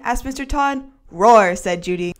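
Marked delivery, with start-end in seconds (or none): 1.1-1.5 loud playful roar; tiger roar. Acted. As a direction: growl it like a grumpy tiger. Judy answers as tiger.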